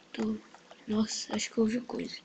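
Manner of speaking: hushed whisper. Real, not acted. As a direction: whisper so nobody hears. Whispering while hiding in game.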